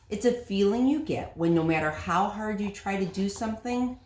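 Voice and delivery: plain reading voice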